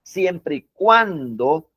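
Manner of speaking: "siempre y cuando" drawn out slowly; slow emphasis. Shown as a demonstration. Teacher warning students, slow and insistent. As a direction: lean on the condition, unhurried.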